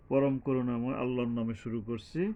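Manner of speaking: normal talking voice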